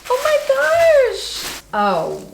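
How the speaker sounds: high, then breathy gosh; excited gasp. Real, not acted. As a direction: gasp it out in delight. Unboxing a gift.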